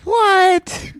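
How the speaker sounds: very high, loud mock-stunned squeal; retold shocked disbelief. Teller's shocked reaction, retold.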